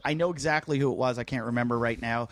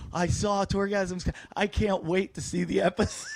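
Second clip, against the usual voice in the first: put-on higher, stretched-out eager girl voice; excited girl; 7 semitones higher than usual. The girl messaging the comic he mocked, excited girl. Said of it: "from the girl going".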